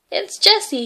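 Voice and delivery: put-on spotting the lost girl, excited; cat shouting with joy. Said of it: "he shouted".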